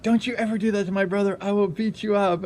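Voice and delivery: high, rapid scolding; imitated angry sister. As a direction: rattle off a protective sister's warning. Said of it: "my sister was mad".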